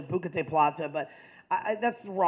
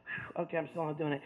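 low, much softer mumble to himself; muttered aside; 4 semitones lower, 7 dB quieter than usual. Retold or imitated. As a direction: say it under your breath. His muttered aside in retelling.

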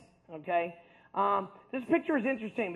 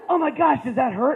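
higher, louder gasp of alarm; shocked friends; 5 semitones higher, 5 dB louder than usual. Retold or imitated. Friends alarmed at the needle, higher and louder. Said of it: "And they're like".

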